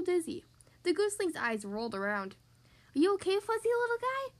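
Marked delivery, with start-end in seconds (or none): 2.9-4.3 Marshall asking. A narrator's reading. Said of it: "Marshall asked".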